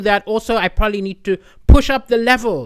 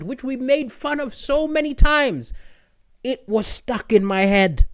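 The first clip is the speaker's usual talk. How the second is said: usual speaking voice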